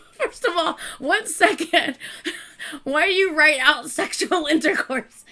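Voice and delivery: real laughter breaking up the words; laughing. Real, not acted. Fan reads the vulgar post aloud, laughing through it. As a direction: try to speak while cracking up.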